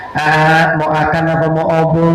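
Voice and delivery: put-on says "akan" for makan and "obo" for bobo, babyish; cute cadel baby talk, dropping consonants; lisping baby talk. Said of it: "ngomong kagak cadel aja dicadel-cadeli".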